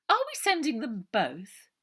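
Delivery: honey-sweet voice hiding malice; treacly Missus Buller. A narrator's reading.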